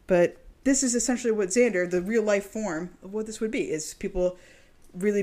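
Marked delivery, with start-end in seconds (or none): none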